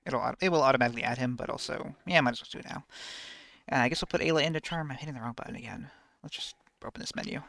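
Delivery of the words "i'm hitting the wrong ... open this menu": whispering. Real, not acted. Whispered aside at menu.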